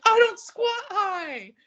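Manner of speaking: very high, loud, slow protest; mock indignant. Denying to the official, very high slow mock protest. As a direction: squeal the denial slowly. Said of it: "And I was like".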